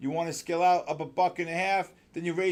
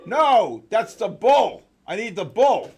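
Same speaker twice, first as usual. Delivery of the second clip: raised, emphatic correction; insistent, much louder protest; loud exasperation; 4 semitones higher, 10 dB louder, slower than usual. Real, not acted. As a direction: call it loudly across to a helper. Wrong sound effect was played.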